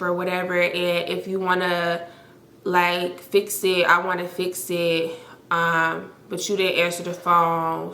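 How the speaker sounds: mock a listless voicemail apology; monotone, unserious. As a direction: imitate someone apologizing without any remorse. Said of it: "it was the most monotone, like, unserious ass voicemail".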